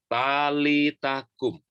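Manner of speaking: Talita kum said slowly, each syllable clear; slow, spelled out. Shown as a demonstration. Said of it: "Coba ngomong sama-sama".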